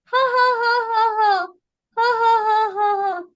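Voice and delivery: high rhythmic ha-ha, stepping down; deliberate yoga laugh; staged laughter. Shown as a demonstration. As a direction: laugh on cue in two even bursts. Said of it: "you just laugh".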